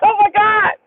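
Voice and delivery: high retold cry of alarm; freaking out. Passenger reacting as the truck slides, panicked. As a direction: exclaim it in comic panic. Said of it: "I'm over there freaking out".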